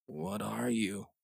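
barely there, as if half gone; dim murmur. Acted. Dazed Brian asks the creature, murmured distantly. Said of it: "Brian murmured dimly, distantly".

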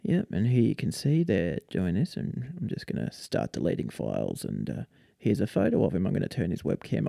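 low, quiet British deadpan narration; soft, dull British monotone. Retold or imitated. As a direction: imitate a dry, unbothered British YouTuber. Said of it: "dull, monotone British voice".

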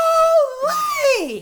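very high, loud drawn-out cry; mimicked crowd shout. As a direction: shriek like a whole crowd reacting. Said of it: "So the crowd goes".

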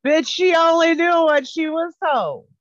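laughing. Real, not acted. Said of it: "Bi-, bitch".

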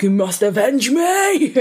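high, drawn-out vengeful plea; milk it as a haunting father's command; ghostly dead dad. Acted. Dead dad haunting his villain son, hammed-up vengeful voice. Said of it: "his dad's like".

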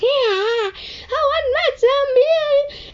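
put-on high, slow whiny toddler wail; crying toddler. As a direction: wail like a little child. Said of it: "the child was like".

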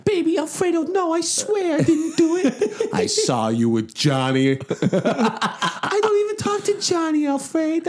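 high, slow pleading wife. Pretend. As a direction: beg your husband, half laughing. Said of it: "That's his wife".

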